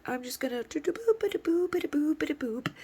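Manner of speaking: high, light sing-song; cutesy, bouncing high pitch; dainty playful voice. Acted. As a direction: skip along daintily in a high little voice. Mimes tiptoeing along gently.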